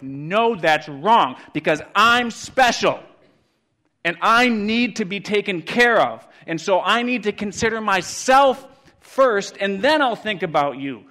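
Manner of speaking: loud, high, emphatic protest; mock self-important shout. Preacher voices the world insisting it comes first, self-important shout. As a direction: shout it like an indignant objector.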